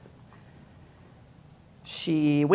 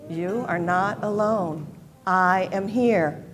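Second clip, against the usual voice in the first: louder and slow, each word firm; slow, loud affirmation; 4 dB louder, slower than usual. Shown as a demonstration. Leading the congregation in the refrain, slow and loud. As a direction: say it out strong for all to join. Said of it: "Say it with me".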